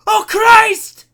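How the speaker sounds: high, full-volume panicked scream; terrified scream. A narrator's reading. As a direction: scream it in horror. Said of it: "dared to look back and scream".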